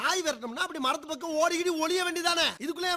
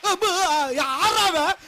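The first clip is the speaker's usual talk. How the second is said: raised, higher-pitched challenge; loud challenge; 4 semitones higher, 7 dB louder, slower than usual. Acted. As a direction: call out sharply to a stranger. Challenges a passer-by loudly.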